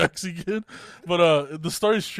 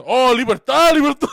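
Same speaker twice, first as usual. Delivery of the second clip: Cuban driver; 7 semitones higher, 12 dB louder than usual. Retold or imitated. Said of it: "That guy turns around and goes".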